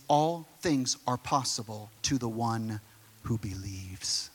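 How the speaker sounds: Jesus answering the father with a slow hush; hushed solemn promise. Retold or imitated. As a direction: let the promise sink in quietly.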